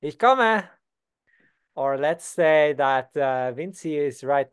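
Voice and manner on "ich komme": called out high and loud; teacher answering the student's call; calling back. Pretend. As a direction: answer across the house that you're on your way. Repeat of the answer.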